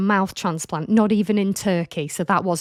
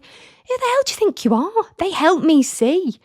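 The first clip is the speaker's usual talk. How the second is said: high, outraged retort; indignant; 9 semitones higher, 3 dB louder than usual. Real, not acted. Host snapping back at commenters mocking her glasses, high and outraged. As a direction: fire it back, properly offended.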